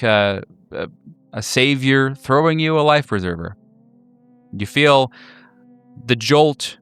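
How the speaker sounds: ordinary delivery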